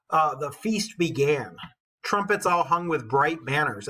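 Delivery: put-on measured storytelling read of an old epic; steady, rhythmic verse narration; poem reading. A narrator's reading. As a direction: read it like a bard to the class. Teacher reads epic poem aloud.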